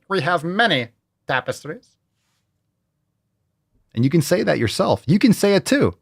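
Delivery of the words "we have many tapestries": mock grand voice. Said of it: "you can go".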